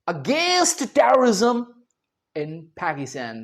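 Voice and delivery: drawn-out American phrase; slow American, fading at "Pækistæn"; heavy American accent. Acted. Teacher replaying the president's words, heavy American accent. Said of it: "heavy सा accent".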